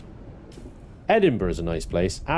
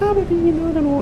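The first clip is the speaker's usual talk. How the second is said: squeaky voice; 4 dB louder than usual. Pretend. Playful aside.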